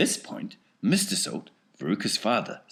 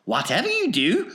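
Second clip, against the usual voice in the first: high, lively showman voice; Wonka's high, bouncy showman voice; eccentric chocolatier; 13 semitones higher, 9 dB louder than usual. Acted. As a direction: play the excitable factory owner, pitched well up. Wonka warning children.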